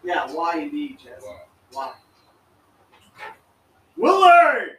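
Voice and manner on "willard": loud high call across yard. Real, not acted. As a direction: shout the name to greet. Calling out to arriving person.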